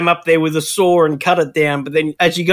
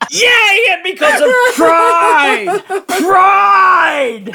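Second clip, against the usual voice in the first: mock preacher shout; 11 semitones higher, 3 dB louder, much slower than usual. Mock hellfire shout about pride.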